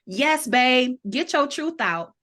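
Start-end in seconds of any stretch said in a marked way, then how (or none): none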